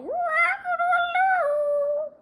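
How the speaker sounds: high, loud, drawn-out rooster call; rooster crow. A narrator's reading. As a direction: crow it like a proud rooster at dawn. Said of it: "He'd fly to the top of Pig's house and call".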